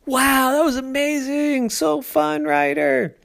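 put-on high, delighted child's voice; a high little girl gushing after the slide; thrilled kid. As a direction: gush about the ride. Sister after the loop.

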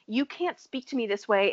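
usual speaking voice

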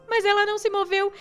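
ordinary delivery, nothing marked

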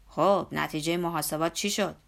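put-on a hearty old worker nagging his boss; Zorba's voice.

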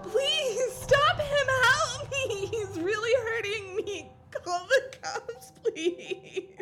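desperate, crying plea for help; weakening pleas. Acted. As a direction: beg bystanders through sobs. Victim begs bystanders, crying.

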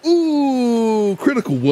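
long, high, loud ooh; excited reaction. Real, not acted. Player reacts to a critical roll, excited. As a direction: draw it out, high and falling.

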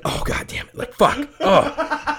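mock groaning curse, leaping up high; imitated pain. Slapped wrestler holding his eye, mock pained cursing. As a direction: act out cursing through an eye injury.

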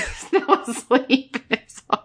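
words breaking up with laughter; laughing while talking. Real, not acted. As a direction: crack up while telling it. Recounting the sedated pig, cracking up.

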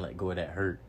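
ordinary talk

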